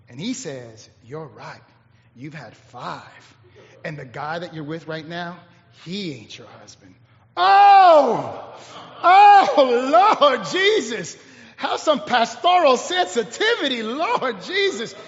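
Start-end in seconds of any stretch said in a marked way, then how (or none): 1.0-6.9 soft, low voice of Jesus; quiet revelation. Acted. Jesus calmly revealing her husbands. 7.3-14.9 mock shock. Mock shock at Jesus's bluntness.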